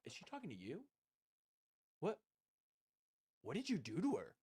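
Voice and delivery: soft, hushed aside as curious onlookers; hushed fans. Retold or imitated. Fans in the stands quietly asking what he did to Leia. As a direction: murmur it like gossiping fans in the stands. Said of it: "they just look at me like".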